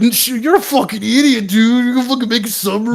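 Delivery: high, loud jeering at a fool; mocking jeer. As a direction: sneer at him like a heckler. People mocking Musk's sub.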